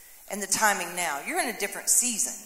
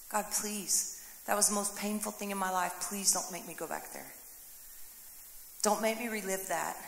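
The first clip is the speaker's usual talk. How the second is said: quiet pleading prayer; 3 semitones lower, 10 dB quieter than usual. Retold or imitated. Preacher voicing his plea to God.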